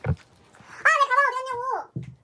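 high, slow frustrated cry; exasperated wail. Real, not acted. Can't find her bag.

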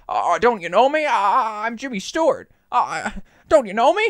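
put-on high, pleading old-movie actor; hammed-up, stretched-out plea of a flustered film star; Jimmy Stewart. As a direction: push the voice up into a hesitant impression. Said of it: "he's like".

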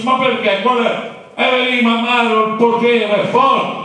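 put-on high, pleading young voice; a worried kid answering Mario; voiced student. Acting dialogue with Capanna. (studente preoccupato, voce acuta)

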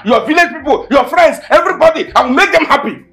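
bitter mocking rant, shouted; angry sarcastic shouting. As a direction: throw her demands back at her in a rising rage. Boyfriend shouts back at girlfriend.

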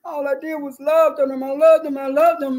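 high-pitched, exasperated lament; angry and drained. Acted. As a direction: protest it, fed up and worn out. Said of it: "you're angry, you're drained".

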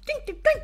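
high sung ding ding; imitated ding. Describing kitchen noises, high imitated ding ding. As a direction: ring out like clinking pots.

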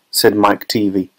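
ordinary delivery

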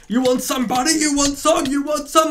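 high, cocky taunt at a rival. Real, not acted. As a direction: goad him into a fight. Taunting opponents mid-fight.

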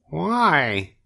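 put-on high, hopeful young pig voice, slow and pleading; a piglet eagerly asking his friend along; Wilbur the pig. Wilbur asks Charlotte to come, high hopeful piglet voice.

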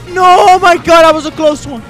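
loud, high cry easing slightly; alarmed yelling. Real, not acted. Gamer after a close one, alarmed yelling. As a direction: yell out after a near miss. Narrow escape in game.

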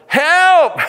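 sudden high yell for help; shouting for help. Retold or imitated. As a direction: cry out help from the sinking boat. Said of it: "they're going".

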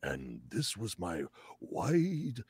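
put-on hushed, dramatic old wizard, kept small in the throat; wise old wizard. Shown as a demonstration. Describing his wizard voice for a casting, hushed old wizard. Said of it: "my wise old wizard voice".

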